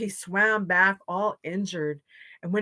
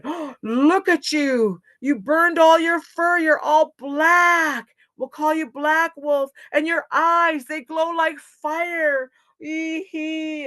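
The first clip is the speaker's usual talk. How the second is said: high, loud drawn-out alarm; shocked alarm; 9 semitones higher, 7 dB louder, slower than usual. A narrator's reading. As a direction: exclaim slowly in scared amazement. Animals shocked at blackened Wolf.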